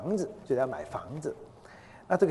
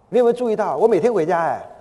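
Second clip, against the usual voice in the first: loud, raised prodding question; insistent bid for attention; impatient husband; 6 semitones higher, 8 dB louder than usual. Retold or imitated. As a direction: act out a husband who can't hold back. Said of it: "他先生忍不住了".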